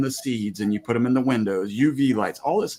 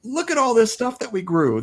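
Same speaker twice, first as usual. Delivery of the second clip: high-pitched, excited mock display; excited showing-off; 12 semitones higher than usual. Parents showing off their garden produce to kids, excited. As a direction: gush proudly over the harvest. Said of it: "they're just as excited as we are".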